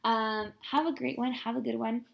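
ordinary delivery

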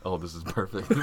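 normal talking voice

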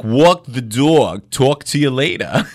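put-on loud, drawn-out New Jersey accent; hammed-up Brooklyn and Jersey accent. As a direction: exaggerate the Brooklyn vowels, slowly. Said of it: "You mean like Brooklyn and New Jersey?".

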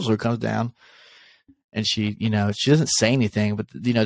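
ordinary talk

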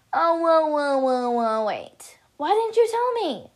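loud string of startled whoas, rising into high protest; indignant, high-pitched demand; shocked protest. Acted. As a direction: burst out in disbelief. Character shocked by withheld news.

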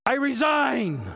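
high, loud cry of giving up; loud acted protest; exasperated outburst. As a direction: throw it out as a fed-up shout. Elijah's outburst to God.

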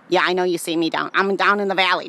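ordinary talk, nothing marked

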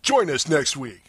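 high, swooping radio DJ hype; drive-time DJ. Pretend. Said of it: "Drive Time D J".

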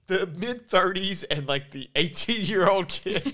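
loud, high, laughing delivery; cracking up. Real, not acted. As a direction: barely get the pitch out through real laughter. Cracking up pitching a movie idea.

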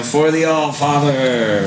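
slow, guttural giant war cry. A narrator's reading. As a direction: bellow it like a giant. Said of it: "Screams out in a guttural giant voice".

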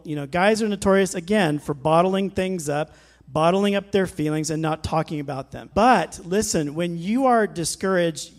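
ordinary talk, nothing marked

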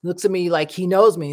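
usual speaking voice

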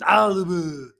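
loud, high strained Austrian grunt; mock strongman yell of frustration; Arnold Schwarzenegger grunt. Host asked for his best impression, loud frustrated grunt. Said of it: "It's a grunt of frustration".

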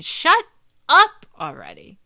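high, loud burst of irritation; exasperated shout. Acted. Snapping at a migraine complainer, exasperated shout. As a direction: yell it as if fed up.

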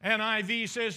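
normal talking voice

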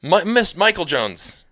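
"Michael Jones" said high, loud and slow; drawn-out name ending. Shown as a demonstration. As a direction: stretch out "Michael Jones" like a sign-off. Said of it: "That's how you end it".

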